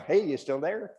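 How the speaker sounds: teasing, high-pitched call over the shoulder; taunting rabbit. Acted. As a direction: egg the chasing dog on. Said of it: "He's looking back every once in a while".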